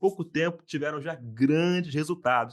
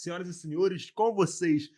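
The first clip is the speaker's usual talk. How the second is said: grand entrance announcement; 5 semitones higher than usual. Acted. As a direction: announce the guests like a showman. Host introduces guests.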